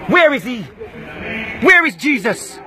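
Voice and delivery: ordinary talk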